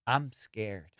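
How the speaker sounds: low, soft deadpan mimicry of a scared friend; imitated timid confession. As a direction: quote it small and low after a pause. Imitating friend admitting fear, big pause.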